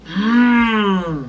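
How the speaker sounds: long, pleased hum of approval; appreciative "hmm". Shown as a demonstration. Asked how the food is, answers with an approving hum. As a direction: hum it as if the food is pretty good. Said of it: "Pretty good".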